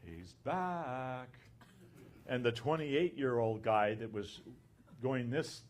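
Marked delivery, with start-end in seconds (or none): 0.0-1.3 a quiet aside, retold lightly; softly retold muttered remark; under his breath. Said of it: "said under his breath".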